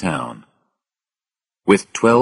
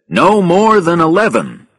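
loud, high and insistent; firm, high-pitched call; calling out orders; 11 semitones higher, 6 dB louder than usual. A narrator's reading. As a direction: warn the crowd in a raised voice. Pilot limits a crowd of passengers.

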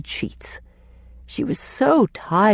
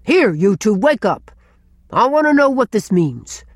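gruff demand to know; rough-voiced, stern challenge; harsh, gruff. A narrator's reading. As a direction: rouse them with a harsh, cross order. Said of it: "saying in a harsh, gruff voice".

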